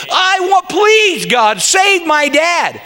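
high-pitched, insistent prayer; bold plea. Acted. Preacher showing how to pray for dad, bold plea. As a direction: beg outright, pitch climbing then easing down. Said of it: "Go ahead and say".

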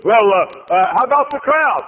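high, loud, excited; eager questioning. Retold or imitated. Visitor quizzes receptionist about glory days, high and excited. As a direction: pepper her with eager questions.

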